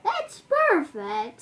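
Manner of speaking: high, loud, slow delight; delighted praise. Acted. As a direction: gush over the new beard. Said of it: "said the cook".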